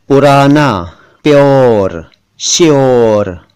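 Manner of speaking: पुराना, प्योर, श्योर drawn out with rolled r; careful र. Shown as a demonstration. Teacher modelling pronunciation, slow rolled र. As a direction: stretch each word and trill its र. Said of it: "जिनमें र है और उसे र ही पढ़ना".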